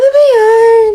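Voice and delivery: very high, loud, slow whine; hammed-up crying whine; whiny wail. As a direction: whine in mock distress. Upset about breakup.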